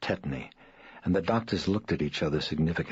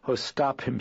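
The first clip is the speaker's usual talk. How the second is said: higher, tearful plea; weeping; 8 semitones higher than usual. Acted. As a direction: beg through tears. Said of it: "she wept".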